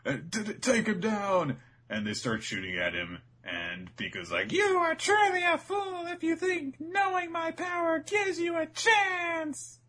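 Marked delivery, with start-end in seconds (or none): 0.0-1.6 high, loud mock crowd alarm; hammed-up henchmen raising the alarm; panicked goobers. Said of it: "a whole bunch of goobers are like". 4.5-9.8 very high, loud squeaky villain boast; mock-menacing falsetto; hammed-up villain. Voicing the giant villain Pika.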